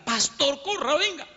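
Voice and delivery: high-pitched mock yell of warning; retold scream. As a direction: imitate a woman urgently calling the pastor in. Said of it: "y me grita". (grito agudo imitado, urgente)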